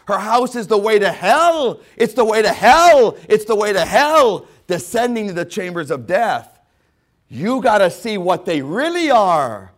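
loud, high sermon shouting; fiery preaching. Real, not acted. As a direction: preach it at full force. Preaching at full force.

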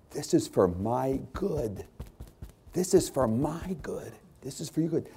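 soft sincere repetition. Acted. Softened, repeated.

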